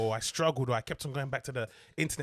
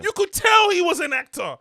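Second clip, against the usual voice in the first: excited; 13 dB louder than usual. Real, not acted. Excited praise of Uncle Phil.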